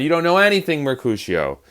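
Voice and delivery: high-pitched scolding, falling on the name; mock yell, pitched high; yelling at a friend. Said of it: "He's yelling at his friend".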